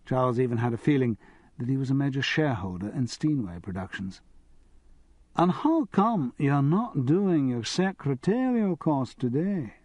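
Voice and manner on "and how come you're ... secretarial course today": put-on slow, genteel Edinburgh Morningside accent, higher; Morningside Scottish accent. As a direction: lay on a refined Edinburgh Morningside lilt. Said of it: "in the Morningside accent".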